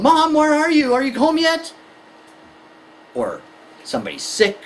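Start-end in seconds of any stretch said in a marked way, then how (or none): 0.0-1.7 high, urgent whine for mom; anxious child phoning. Acted. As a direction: call out like a worried kid. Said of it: "on the phone".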